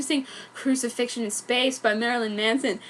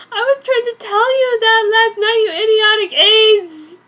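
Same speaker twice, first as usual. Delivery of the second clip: much louder, higher, crying; tearful furious shout; 9 semitones higher, 10 dB louder than usual. Acted. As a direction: yell at him through tears.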